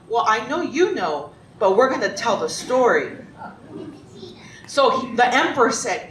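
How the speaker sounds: ordinary delivery, nothing marked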